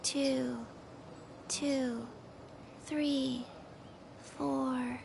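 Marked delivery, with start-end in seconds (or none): none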